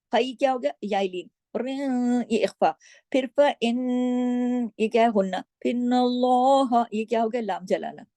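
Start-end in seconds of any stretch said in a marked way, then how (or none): 1.6-6.8 slow chanted pieces of the verse; recitation demo. Shown as a demonstration. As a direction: chant each piece slowly.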